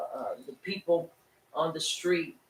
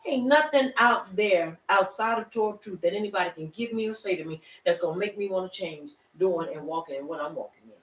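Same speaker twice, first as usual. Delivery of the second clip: higher, louder, driving sermon delivery; full-force preaching; 4 semitones higher, 4 dB louder than usual. Acted. Preacher urging the congregation to stand firm, fervent preaching. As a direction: preach it with rising fire.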